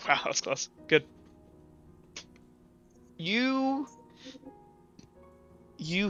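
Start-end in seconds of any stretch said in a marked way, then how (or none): none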